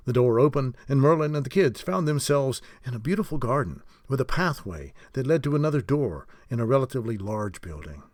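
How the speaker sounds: ordinary delivery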